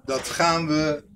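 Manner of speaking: slow, measured words; drawn out, word by word; slow dictation. Real, not acted. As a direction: say it slowly as if writing it down. Writing the next line down.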